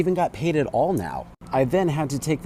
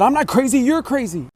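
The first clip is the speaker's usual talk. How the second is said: mock angry retort; 12 semitones higher, 6 dB louder than usual. Retold agent's angry retort.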